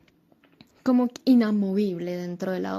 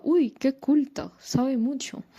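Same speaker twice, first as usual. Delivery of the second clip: higher, drawn-out mock admiration; imagined admiring audience; 4 semitones higher, slower than usual. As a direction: act out an impressed spectator cooing praise. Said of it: "la gente vea mi, mi monólogo y diga".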